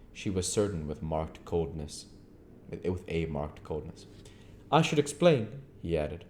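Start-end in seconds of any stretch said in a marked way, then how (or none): 4.7-5.5 higher, curt stranger; stranger's voice. Acted. Stranger answering Henfrey, higher curt voice. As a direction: play the aloof stranger, pitched up.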